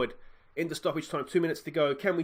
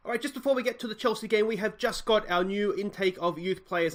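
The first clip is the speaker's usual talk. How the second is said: higher, faster channel-host voice; youtuber hype; 4 semitones higher, 4 dB louder than usual. Acted. Host tells viewers about naming youth players, higher and brisk. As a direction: pitch it to the viewers.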